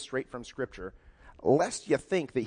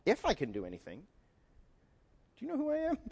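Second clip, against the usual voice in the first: If I can rising, then Do you know who I am pitched far higher; incredulous echo, jumping up high at the end; Jesus stunned by the father's lack of faith; shocked disbelief; 9 semitones higher, faster than usual. Retold or imitated. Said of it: "Jesus is kind of shocked".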